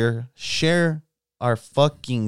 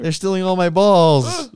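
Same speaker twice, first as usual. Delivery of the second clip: high, loud mock protest; mock distress cry; 7 semitones higher, 5 dB louder than usual. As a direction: wail it as a hammed-up complaint. Hammed-up cry in absurd bit.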